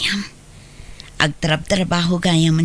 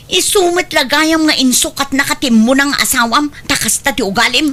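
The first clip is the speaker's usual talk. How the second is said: louder, higher bitter rebuke; angry rebuke; 6 semitones higher, 3 dB louder than usual. Acted. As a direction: spit out his faults. Wife berates husband. (nakapungtot nga panangbabalaw)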